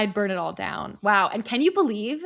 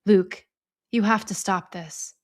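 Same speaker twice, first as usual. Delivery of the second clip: staging an intervention; 2 semitones lower, slower than usual. Retold or imitated. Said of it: "Best Intervention Voice".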